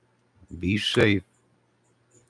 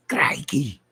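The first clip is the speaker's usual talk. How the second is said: put-on Crikey! pitched high in a playful Australian accent, lighter than the first; mock Aussie crikey; 8 semitones higher than usual. Streamer repeating his Australian joke for the viewer in a hammed-up Australian accent. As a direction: toss off another cartoon Australian crikey. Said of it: "from Australia".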